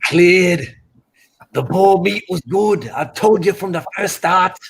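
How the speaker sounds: put-on British-Irish traveller accent; boastful boxer's brag in a mock Irish accent; Fury. Friend mimics a boxer for laughs, mock Irish accent. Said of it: "how was my accent".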